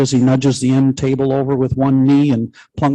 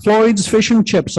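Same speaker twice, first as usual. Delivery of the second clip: higher, slow, sing-song phone greeting; waitress voice; 7 semitones higher, slower than usual. Pretend. Mary answering the phone as a fish-and-chip waitress. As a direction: play a chirpy take-out waitress answering. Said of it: "puts on my best waitress voice".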